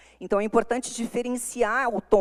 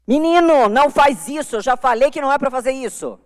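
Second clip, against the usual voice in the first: much higher and louder, a parent's angry telling-off; irritated scolding; 8 semitones higher, 8 dB louder than usual. Shown as a demonstration. Parent scolding a child, demo of an irritated tone. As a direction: scold the boy sharply. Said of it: "Esse tom é irritado".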